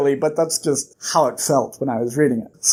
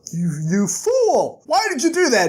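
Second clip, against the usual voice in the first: aghast scolding; 8 semitones higher than usual. Acted. Kvothe scolds Bast.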